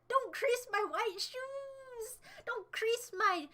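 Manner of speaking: high, slow, whining over the shoes; hammed-up fuss, dragged out high; mock whiny plea.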